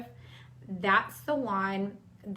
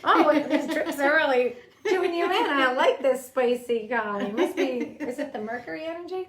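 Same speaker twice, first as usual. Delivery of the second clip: playful teasing; 5 semitones higher, 8 dB louder than usual. Real, not acted. Host teasing cohost playfully.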